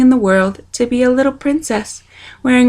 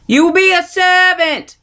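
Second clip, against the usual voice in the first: shouted; 9 semitones higher than usual. A narrator's reading. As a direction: shout it so she can hear. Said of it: "shouted a soldier".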